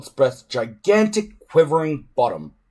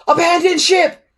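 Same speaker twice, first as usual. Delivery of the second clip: high, loud alarmed shriek; 8 dB louder than usual. A narrator's reading. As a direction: shriek the order in panic. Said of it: "shrieked Fireworm".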